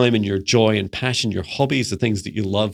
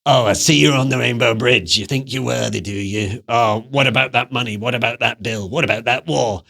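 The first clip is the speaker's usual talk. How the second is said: troll voice. Acted. Troll taunting the viewer on the rainbow bridge, sneering troll voice. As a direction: needle them like a mocking bridge troll. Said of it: "you hear the troll saying".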